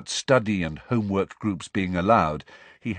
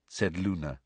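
ordinary delivery, nothing marked

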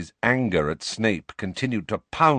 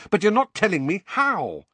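frustrated protest, raised pitch; angry; 11 semitones higher than usual. Acted. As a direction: complain hotly at the teacher. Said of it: "said Harry angrily".

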